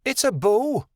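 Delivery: high, excited character cry; sudden high-pitched outburst; abrupt shout. A narrator's reading. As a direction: exclaim it suddenly, pitched well up. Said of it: "Adelaide shouted abruptly".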